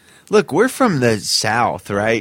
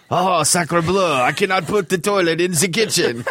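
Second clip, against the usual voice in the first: put-on French accent; 6 semitones higher than usual. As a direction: ham up a French designer. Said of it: "Francois sitting in the, designing a home".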